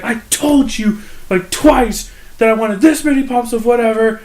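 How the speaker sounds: high, drawn-out imitation of an angry customer; mock flipping out. Irate customer complains about her order, mock flipping out. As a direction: scold in a high, exasperated mock voice. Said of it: "she starts flipping out".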